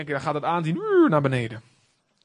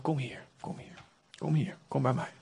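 tender whisper; 2 semitones lower, 9 dB quieter than usual. Acted. Holy Spirit gently calling sinner.

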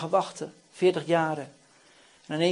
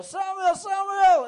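high, ringing call of a name; raised, summoning cry; 11 semitones higher, 3 dB louder, slower than usual. Acted. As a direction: call as if to wake someone far away. God calling out to Samuel.

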